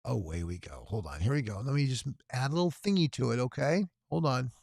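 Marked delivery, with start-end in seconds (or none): none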